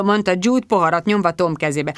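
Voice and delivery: ordinary delivery, nothing marked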